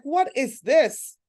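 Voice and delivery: slow, stunned what is this; mock shocked question; disbelief. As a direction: drag it out in disbelief at the nails. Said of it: "I was like".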